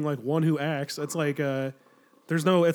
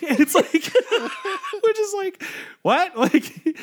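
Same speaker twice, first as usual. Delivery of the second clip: words squeezed out through high, loud laughter; can't stop laughing while telling it; laughing hard; 5 dB louder, slower than usual. Real, not acted. Cracking up at the story.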